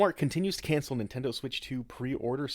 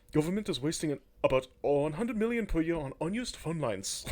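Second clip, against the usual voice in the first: louder, higher mock-angry Musk; pissed-off reread; 6 semitones higher, 4 dB louder than usual. Host rereads the Musk post, now mock pissed off and louder. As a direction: reread it like he's fuming. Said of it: "He's, like, pissed off about it".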